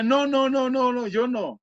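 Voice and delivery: high, sustained mock whine; panicked refusal. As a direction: act out a string of pleading refusals. Her refusal repeated in Spanish. (negativa quejumbrosa imitada)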